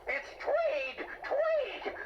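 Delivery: shrill furious yell of his name; angry correction. Acted. Tweed yelling at Albie over his name. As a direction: snap the name back, high and hot.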